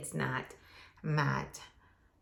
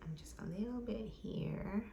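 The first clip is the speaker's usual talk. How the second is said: whispering; 5 semitones higher, 8 dB quieter than usual. Real, not acted. Murmuring while filling in brows.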